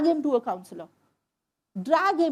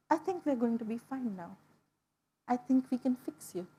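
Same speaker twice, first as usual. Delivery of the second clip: softer, hushed comfort; a calm, tender promise to a crying patient; gentle reassurance; 2 semitones lower, 8 dB quieter than usual. Retold or imitated. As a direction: soothe her like a kind healer. Healer holds her hand gently.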